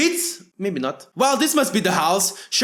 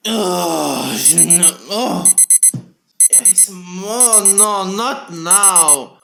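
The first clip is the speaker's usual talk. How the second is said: groggy half asleep; 4 semitones lower, much slower than usual. Acted. Groggy waking, snoozing alarm.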